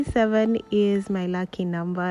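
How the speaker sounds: normal talking voice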